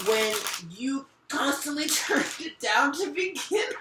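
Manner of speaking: can't hold back the giggles; laughing. Real, not acted. Reader laughs at the masculine's excuses. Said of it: "I shouldn't be laughing".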